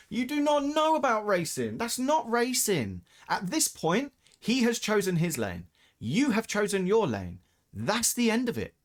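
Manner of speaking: rising outrage; heated rant. Real, not acted. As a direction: lecture the bad driver, worked up.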